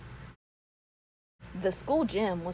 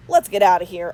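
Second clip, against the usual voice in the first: let's go; 10 dB louder, faster than usual. A narrator's reading. Bored boy leaving ride.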